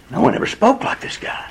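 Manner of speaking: whispered, high, astonished; hushed disbelief at what they heard; amazed whisper. Retold or imitated. The officers answer the priests in a hushed, amazed whisper.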